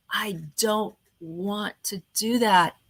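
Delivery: slow dull flat delivery of the line; boring, dry. Shown as a demonstration. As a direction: drag it out, bored and lifeless. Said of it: "in that voice of ... boring, dry".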